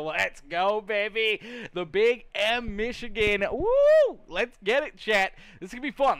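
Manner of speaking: loud, high hype for the chat; hyped up. Real, not acted. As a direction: pump up the crowd, loud. Hyping chat before race.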